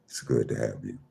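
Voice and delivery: ordinary talk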